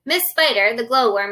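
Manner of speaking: ordinary delivery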